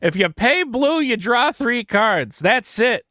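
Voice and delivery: high, emphatic retold argument; exasperated rebuttal. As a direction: spell it out in a high voice, drawing out key phrases. Retelling own exasperated rebuttal.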